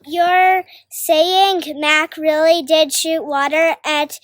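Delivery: usual speaking voice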